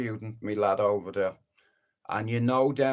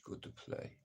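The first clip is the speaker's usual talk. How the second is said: whispered aside; 19 dB quieter than usual. Real, not acted. Quiet aside after loud rant.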